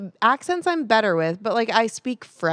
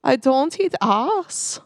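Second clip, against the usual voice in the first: very slow, higher, put-on German accent; 4 semitones higher, much slower than usual. Shown as a demonstration. Co-host trying accents on a test phrase, German accent. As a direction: try a heavy German accent, drawing out each word. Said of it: "let me try the German".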